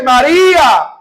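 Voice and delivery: loud, high call across a garden; calling out a name. Acted. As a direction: call her name so she knows you. Jesus calls Mary by name.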